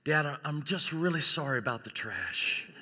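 soft, hesitant acted sorry; subdued apology. New mother apologizes to dad at hospital, subdued. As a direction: confess it quietly, as at a bedside.

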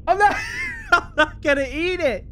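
laughing scared refusal; nervous laughing. Real, not acted. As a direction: laugh through the fear. Forced to eat pizza in game.

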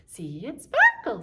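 sparkle sung up high and loud. Shown as a demonstration. Teacher going high on the rhyme for kids. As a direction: lift your voice high on "sparkle". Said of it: "make our voice go really high".

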